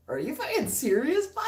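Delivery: high-pitched, indignant disbelief; acting out an outraged comeback; incredulous retort. Acted incredulous retort to threat.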